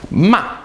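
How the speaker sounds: high "Ma" cut sharply downward; fourth-tone "Ma", abrupt fall. Shown as a demonstration. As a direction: drop "Ma" steeply from the top. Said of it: "goes down sharply".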